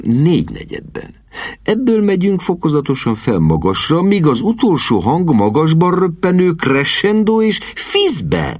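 put-on instructing young expert. A narrator's reading. Young man teaching the lord the call, instructive. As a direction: lecture that climbs in pitch as it goes.

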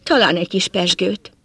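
usual speaking voice

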